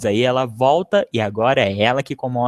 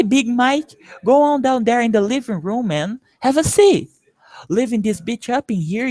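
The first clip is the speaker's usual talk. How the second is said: lively, higher street-talk voice for the neighborhood guys; acting out movie scene; 8 semitones higher than usual. Teacher voicing neighborhood guys in a film scene, lively higher voice. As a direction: play the homeboys welcoming Big Mike.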